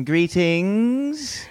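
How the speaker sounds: high, drawn-out theatrical "Greetings"; grand greeting. Pretend. Co-host opening the show with a grand drawn-out greeting. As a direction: announce yourself like a herald.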